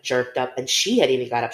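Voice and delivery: usual speaking voice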